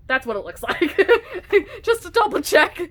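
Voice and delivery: giggling through the words; laughing. Real, not acted. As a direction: laugh while talking. Laughing at own bookmark shot.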